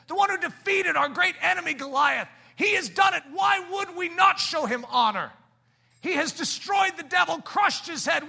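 rising shout of a preacher, high and loud; preaching at full force. Acted. Preacher shouting to the church about honoring David. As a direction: thunder it out to the congregation.

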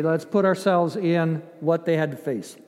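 ordinary talk, nothing marked

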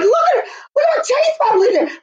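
very high, squealing show-off to Mum; imitating excited kid. Acting her excited younger self.

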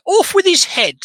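high-pitched, dramatic flourish; dramatic exclamation. Retold or imitated. Podcaster retelling the sword kill, dramatic exclamation. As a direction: launch it up high like a retold death blow.

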